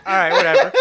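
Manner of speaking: imitating a fighter laughing it off; laughing dismissal. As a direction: wave it away while laughing. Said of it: "He was like".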